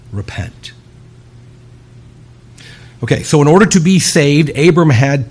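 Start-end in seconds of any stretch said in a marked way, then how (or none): 0.1-0.7 "Repent" breathed as a soft, low whisper. Acted. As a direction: whisper "Repent" like a final plea. Preacher whispers final call.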